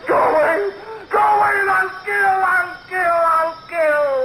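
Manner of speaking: high furious shout; mad shouting. Acted. Darrell shouts at the voices before killing, mad shouting. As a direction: scream it as madness takes over.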